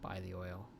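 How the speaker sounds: low, soft subliminal voice; subliminal whisper. Pretend. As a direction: murmur it like a hidden message. Subliminal message to customers.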